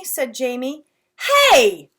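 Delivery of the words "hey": loud sharp mom yell. Acted. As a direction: cut him off with a loud "Hey!". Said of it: "Mom yelled".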